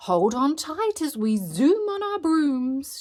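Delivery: high, loud teacher's call; excited shouted warning. A narrator's reading. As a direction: lead children on a thrilling ride. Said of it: "she called".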